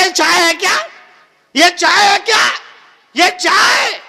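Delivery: loud, high angry outburst; scolding mother-in-law. Acted. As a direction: rail loudly at the daughter-in-law. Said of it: "बहुत बड़बड़ाई". (सास की ऊँची, गुस्से भरी डाँट)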